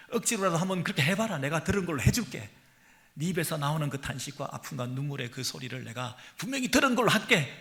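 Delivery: high, loud pleading sermon voice; fervent preaching. Acted. Preacher voices God begging the people to speak, loud and high. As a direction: plead with them, rising to a cry.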